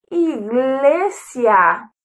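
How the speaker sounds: iglesia stretched syllable by syllable, slow; slow drawn-out. Shown as a demonstration. Teacher modelling iglesia slowly for children. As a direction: draw out iglesia slowly for the kids. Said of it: "Vamos todos juntos".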